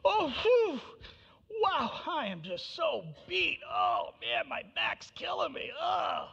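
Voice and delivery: high, loud groaning complaints of a worn-out God; puffing and moaning in an overdone tired act; mock exhaustion. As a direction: ham it up like you're utterly beat after hard work. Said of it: "was God like".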